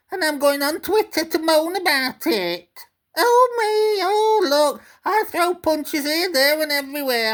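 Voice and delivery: high, whining mock voice with oh-oh moans; whiny Gary impression. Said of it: "and he's bloody whinging".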